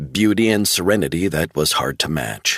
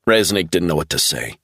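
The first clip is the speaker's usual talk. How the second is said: usual speaking voice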